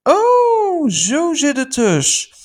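high, slow amazed "oh"; surprised realization. Retold or imitated. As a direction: gasp of sudden understanding. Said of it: "Ik denk".

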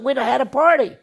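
angry Laban. Retold or imitated. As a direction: let the hurt father rage at the runaway. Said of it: "You can see him getting mad".

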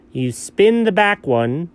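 normal talking voice

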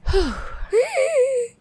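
long high weary sigh; drained sigh after coughing; tired sigh. Real, not acted. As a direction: give up with a big sigh. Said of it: "tired sigh".

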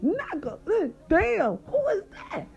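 mock high-pitched amazement, words drawn out at the end; excited friends. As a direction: imitate the boys in a high, excited voice. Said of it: "his boys like".

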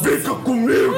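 voz assombrada, bem mais aguda; sete vozes sobrepostas. Pretend. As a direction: imite um coro fantasmagórico de menininha. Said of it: "Com sete vozes sobrepostas". (voz de assombração exagerada)

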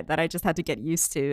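ordinary delivery

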